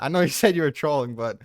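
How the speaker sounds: normal talking voice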